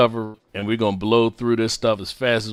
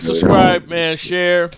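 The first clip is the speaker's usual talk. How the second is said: ordinary talk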